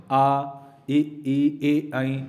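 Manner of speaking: आ, ई, ए, ऐ said with spread, unrounded lips; unrounded vowels. Shown as a demonstration. Teacher voices आ ई ए ऐ to show unrounded lips. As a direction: say आ ई ए ऐ without rounding the lips. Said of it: "क्या होठ गोलाकार हो रहे हैं".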